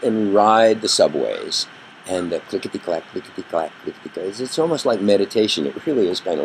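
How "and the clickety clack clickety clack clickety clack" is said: rhythmic clickety-clack that fades away quietly; train rhythm fading. Retold or imitated. Evoking the subway ride, rhythmic and fading. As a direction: let the train sound die off into a hush.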